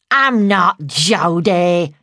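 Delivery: loud, slow, gruff growl. A narrator's reading. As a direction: growl it as a menacing male alter ego. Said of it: "she growled".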